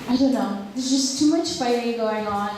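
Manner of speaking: normal talking voice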